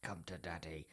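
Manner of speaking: low, hushed creepy villain line. Acted. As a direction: say it low and soft, mildly creepy.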